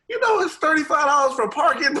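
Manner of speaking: laughing through a high, loud dad voice; half-laughed, half-shouted impression of the father; mock dad. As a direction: ham up the cheap dad's quip while cracking up. Dad's quip at the hotel, retold.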